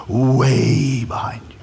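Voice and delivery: whispered, slow, shrinking away; timid whisper. Acted. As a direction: breathe it like you want to hide. Moses shrinking back from God.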